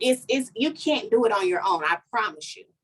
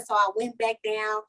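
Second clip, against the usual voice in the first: ordinary delivery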